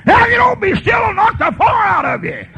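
high, loud hammed-up yell with a laugh; mock shouted threat. Preacher mimicking a father yelling at his kids. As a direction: blast the threat like an angry father. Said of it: "with just as much force".

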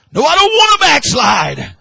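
high, loud and full-throated cry; fervent shout. Real, not acted. Preacher at the climax crying out to the church. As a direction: cry it out over the congregation.

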